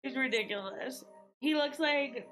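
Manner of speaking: ordinary talk, nothing marked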